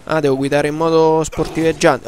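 ordinary delivery

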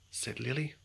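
plain reading voice

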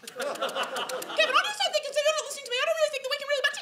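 extremely high, squealing complaint; tipsier, piercingly high nagging caricature; pterodactyl woman. Retold or imitated. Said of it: "second Prosecco and she's like".

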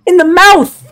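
high, loud gamer rage rant; furious rant. Real, not acted. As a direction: rant at the screen in outrage. Ranting about surviving enemy.

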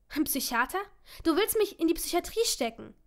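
shocked. Acted. Shocked at the suggestion.

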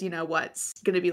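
normal talking voice

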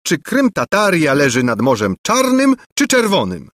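high, scornful taunting. A narrator's reading. Angelika taunts the ignorant prince, high and scornful. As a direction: needle him with scorn. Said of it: "przedrzeźniając dalej biednego Lulejkę".

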